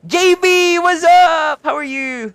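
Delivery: high, loud, excited hello; shouted stream welcome; loud greeting. Real, not acted. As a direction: call out the greeting brightly.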